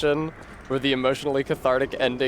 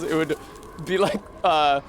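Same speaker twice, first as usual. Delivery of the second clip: ordinary talk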